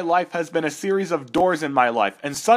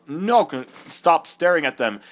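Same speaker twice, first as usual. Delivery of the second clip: ordinary talk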